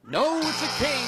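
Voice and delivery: high-pitched game-show exclamation; dramatic bad-news reveal. Real, not acted. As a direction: call out the losing card. Host reveals a losing card.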